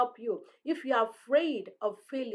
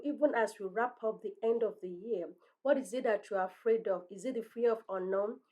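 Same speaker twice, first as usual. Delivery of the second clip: ordinary talk, nothing marked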